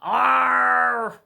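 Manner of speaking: loud, high roaring growl; a storybook troll bursting in with a roar; horrible troll roar. A narrator's reading. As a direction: let out a monstrous troll roar. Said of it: "making a horrible sound".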